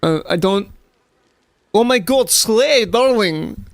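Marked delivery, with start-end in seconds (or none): 1.7-3.7 mock Russian accent. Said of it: "can't do that in, like, a proper Russian accent".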